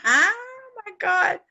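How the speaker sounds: high, slow words through real laughter; laughing. Real, not acted. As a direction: crack up at the punchline. Host cracks up at the story.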